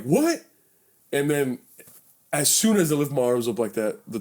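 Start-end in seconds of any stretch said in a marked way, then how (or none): 0.0-0.4 high, challenging what; retold defiance. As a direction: act out squaring up to a cop. Defiant reply to cop, retold.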